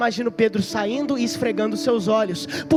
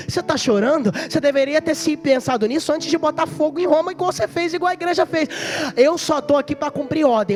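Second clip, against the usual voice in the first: higher, louder taunting executioner; mocking executioner; 5 semitones higher, 4 dB louder than usual. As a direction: sneer at the prisoner's tears from above. Executioner taunts weeping Peter.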